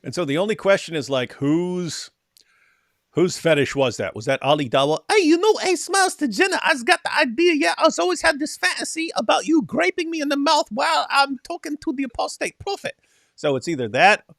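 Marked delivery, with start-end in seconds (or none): 5.1-12.9 high, put-on mocking voice; mock debater voice. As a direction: play him up as a giddy fool. Imagining debater's fantasy line.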